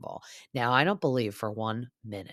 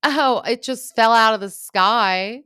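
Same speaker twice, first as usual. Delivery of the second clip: much higher, louder, slow faux-innocent voice; much higher, louder, slow mocking of Lala's innocent excuse; 8 semitones higher, 9 dB louder, slower than usual. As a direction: play dumb in a sing-song mocking Lala. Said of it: "be like".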